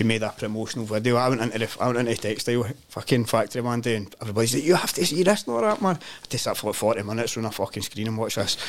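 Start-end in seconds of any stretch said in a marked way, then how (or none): 4.6-6.0 high, eager coworkers insisting he watch; excited urging. Retold or imitated. Workmates pushing him to watch, eager and high. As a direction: pitch it up like keen workmates.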